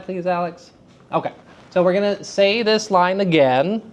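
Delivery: ordinary delivery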